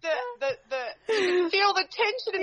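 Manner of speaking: talks through real laughter, pitched high; giggling so hard the words wobble; laughing as she tells the radio host. Real, not acted. Laughing while telling.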